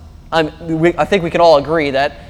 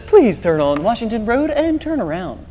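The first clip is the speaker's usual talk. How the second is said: mock robotic navigation voice, softer; a man parodying his GPS telling him to turn around; 5 semitones higher, 4 dB quieter than usual. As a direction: imitate the calm car navigator. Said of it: "and then it says".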